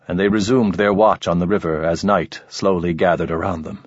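ordinary delivery

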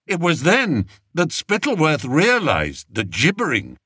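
plain reading voice

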